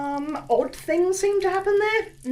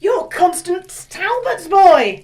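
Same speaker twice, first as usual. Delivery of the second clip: slow astonished recognition; 4 semitones higher, 8 dB louder, much slower than usual. Acted. Landlady recognises his mother's son.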